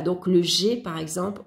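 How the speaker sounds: ordinary talk, nothing marked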